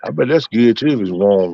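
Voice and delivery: normal talking voice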